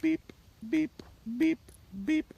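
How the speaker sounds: spaced-out high beeps; slow whistle beeps. Shown as a demonstration. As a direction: pace the whistle blasts slowly and evenly. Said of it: "slow, methodical beep".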